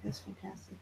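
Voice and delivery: breathless whispered awe; awed whisper. Retold or imitated. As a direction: barely breathe it out.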